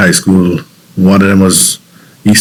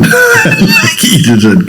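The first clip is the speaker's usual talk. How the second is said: laughing; much slower than usual. Real, not acted. Fond memory of stealing sugarcane.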